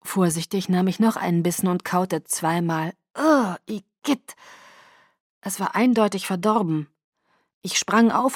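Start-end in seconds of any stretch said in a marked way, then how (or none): 3.2-4.4 slow, higher groan of disgust. Acted. Woman tasting a spoiled bite of chicken, disgusted. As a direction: draw it out, revolted by the taste.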